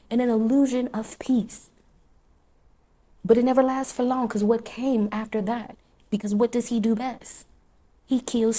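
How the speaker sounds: loud, high preaching cadence; full-force preaching. Acted. Preacher warning listeners about the enemy, loud and driving. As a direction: drive each now you in home like a pulpit.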